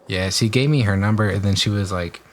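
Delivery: normal talking voice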